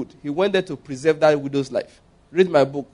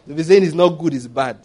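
ordinary delivery

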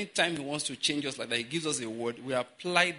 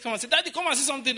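put-on high, louder little-girl voice; imitating daughter; 12 semitones higher, 5 dB louder, much faster than usual. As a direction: call Daddy as a child would. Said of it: "my daughter said".

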